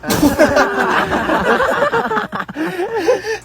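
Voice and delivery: high, cartoonish cackle; silly high-pitched cartoon laugh; odd high laugh. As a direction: break into a high, strange laugh. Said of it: "Te, anong nangyari sa'yo?".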